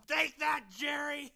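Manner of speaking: taunting Jerry with a loud triumphant yell; gloating shout. Real, not acted.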